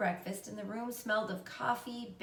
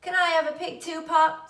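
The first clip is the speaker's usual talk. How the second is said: high, loud begging; eager shout; 8 semitones higher, 10 dB louder than usual. A narrator's reading. Avery to his father, high loud eager plea. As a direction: call out eagerly for his own.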